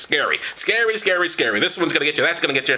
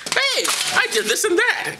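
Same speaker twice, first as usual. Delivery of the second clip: much higher, smug bragging; boastful high voice; slower than usual. Retold or imitated. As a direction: brag in a high squeaky voice. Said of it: "this other motherfucker is like".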